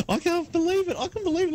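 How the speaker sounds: high laughing disbelief. Real, not acted. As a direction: squeal it through laughter. Reacting to absurd coffee.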